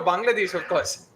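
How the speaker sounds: usual speaking voice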